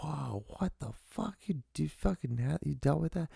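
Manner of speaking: high, soft disbelieving wow; imitating shocked friend. Friend hearing about the beatings, high mock shock. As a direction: mimic a stunned friend. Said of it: "my friend's like".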